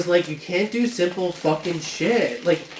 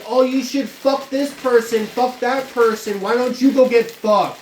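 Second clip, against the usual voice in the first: higher, sneering angry quote; mock angry boss; 6 semitones higher, 4 dB louder than usual. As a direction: throw the boss's insult back, bitter and mocking. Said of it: "have the audacity to be like".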